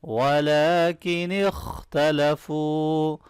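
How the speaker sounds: وَلَكِنِ اخْتَلَفُوا with laa and final fuu stretched slowly; slow tajweed chant; long vowels. Shown as a demonstration. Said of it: "آخر میں واو مدہ کو بھی لمبا کرنا ہے".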